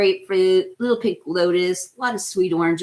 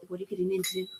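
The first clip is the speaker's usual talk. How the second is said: "What are you getting into?" said much softer, almost under the breath; quiet mock question; 10 dB quieter, much faster than usual. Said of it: "I always laugh when people".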